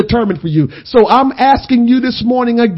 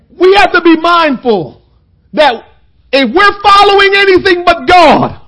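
louder, higher, shouted close; preaching climax; 5 semitones higher, 6 dB louder than usual. Acted. Preacher closing to the congregation, shouted climax. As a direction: ring it out to the church.

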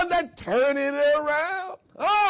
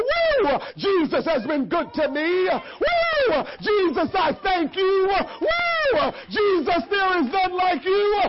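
joyful shout; 3 semitones higher, slower than usual. Shown as a demonstration. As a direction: burst in whooping like you own the room. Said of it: "I'm gonna say".

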